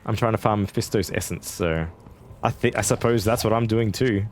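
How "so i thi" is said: ordinary talk, nothing marked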